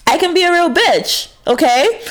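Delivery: high, sassy, proud of it; sassy admission. Shown as a demonstration. Owning her flaws to the viewers, high and sassy. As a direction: own it with a high, cheeky lilt. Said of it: "that's me being an asshole".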